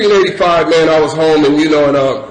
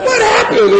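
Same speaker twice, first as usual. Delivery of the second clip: very high mock-panicked cries; scared. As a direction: wail it in comic fright. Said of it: "I'm just scared".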